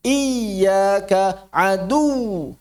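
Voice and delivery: "Iyyaka adu" very slow, voice lifted on the doubled y. Shown as a demonstration. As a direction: lift and stretch the start of "Iyyaka". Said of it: "Iya ditinggikan".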